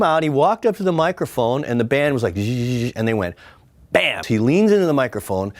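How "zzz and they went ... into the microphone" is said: ordinary talk, nothing marked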